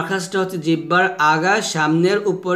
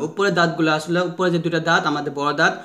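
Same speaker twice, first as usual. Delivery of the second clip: ordinary talk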